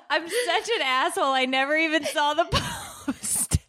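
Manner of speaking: high, tearful self-reproach; real crying mixed with laughs. Real, not acted. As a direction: wail it guiltily through laughter. Crying with guilt over missed post.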